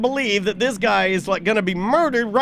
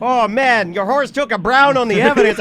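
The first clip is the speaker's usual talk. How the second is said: exaggerated mock Canadian accent; horrible Canadian accent; 4 dB louder than usual. Mountie griping about a horse on the evidence, in a mock Canadian accent. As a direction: play it as a bad Canadian impersonation. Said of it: "that's a horrible Canadian accent".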